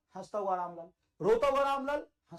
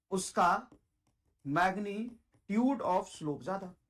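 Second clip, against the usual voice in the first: very slow, word-by-word dictation; slow emphatic repeat; 3 semitones lower, much slower than usual. Shown as a demonstration. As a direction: draw out magnitude syllable by syllable. Teacher repeats rule very slowly.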